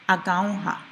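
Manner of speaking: "agaoha" as a-GAU-ha, short punch on gau; short stress. Shown as a demonstration. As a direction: punch the second-last syllable of "agaoha". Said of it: "has a short stress on there".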